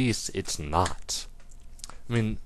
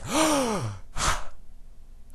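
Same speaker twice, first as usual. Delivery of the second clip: gasp. Pretend. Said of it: "I'm about to run out of air".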